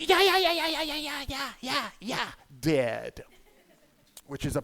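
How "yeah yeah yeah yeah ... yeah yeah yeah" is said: high-pitched rapid-fire squawks falling in pitch; frantic rooster squawking. Acted. Comic on fighting gamecocks getting killed, frantic squawking. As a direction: squawk like a gamecock that won't flee. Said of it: "They're just like".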